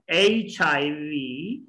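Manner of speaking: very slow H I V as "ey-chai-vee"; drawn-out H I V for copying; say after me. Shown as a demonstration. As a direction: stretch and link H I V. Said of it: "try to say after me".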